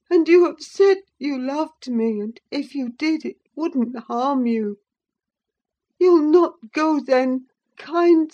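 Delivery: high, loud tearful begging; sobbing terror. A narrator's reading. Linton sobbing and begging Catherine to stay. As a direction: plead for your life through tears. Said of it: "he sobbed".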